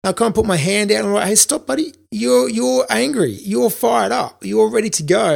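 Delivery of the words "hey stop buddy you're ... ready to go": put-on calming an angry kid. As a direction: ease him off gently, like talking down a fighter. Calming a student who wants to fight.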